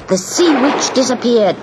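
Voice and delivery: usual speaking voice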